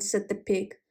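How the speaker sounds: ordinary delivery, nothing marked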